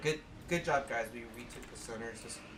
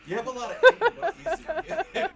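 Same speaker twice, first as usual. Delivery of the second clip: high, loud, giggling tease; teasing laugh; 11 semitones higher, 12 dB louder, much faster than usual. Real, not acted. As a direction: tease him through the laughter. Teasing friend about anger.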